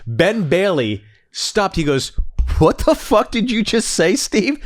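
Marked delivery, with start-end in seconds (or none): 2.6-4.6 mock stunned. Ben Bailey stunned reaction.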